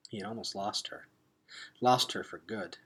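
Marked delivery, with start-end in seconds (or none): none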